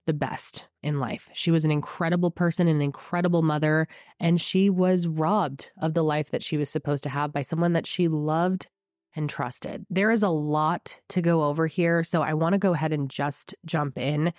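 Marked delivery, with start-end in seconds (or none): none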